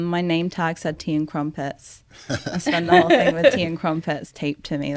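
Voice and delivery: normal talking voice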